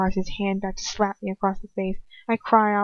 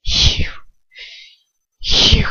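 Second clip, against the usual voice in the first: loud sputtering rage, louder; 7 dB louder than usual. A narrator's reading. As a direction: choke out the words in fury. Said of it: "he sputters".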